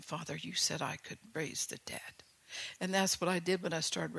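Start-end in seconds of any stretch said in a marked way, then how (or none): 0.0-2.2 whispered prayer. Shown as a demonstration. Models prayer for listeners.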